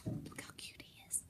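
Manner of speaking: hushed, delighted whisper. Real, not acted. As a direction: lean in and whisper how cute he is. Aside whisper to audience.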